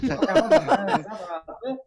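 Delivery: high, loud disbelieving question; retold incredulity. Director challenging him on the French accent, loudly incredulous.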